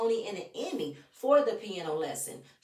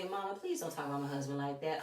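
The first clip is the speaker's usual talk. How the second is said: soft, quick and measured, a gentle plea; calm and respectful; 6 semitones lower, 8 dB quieter, faster than usual. Pretend. Daughter calmly asks her mother to stop, softly. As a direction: keep it soft but firm, talking to your mother. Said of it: "very calmly".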